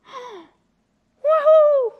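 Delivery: high, loud cry of joy; joyful shout. A narrator's reading. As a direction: whoop with delight. Child finds presents.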